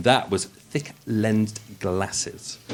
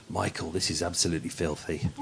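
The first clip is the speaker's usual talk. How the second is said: ordinary delivery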